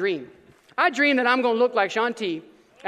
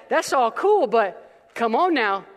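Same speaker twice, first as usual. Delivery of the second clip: ordinary talk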